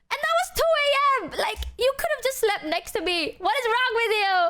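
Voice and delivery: high-pitched indignant rant; mock outrage. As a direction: wail it out in exaggerated disbelief. Mock outrage at friend who left.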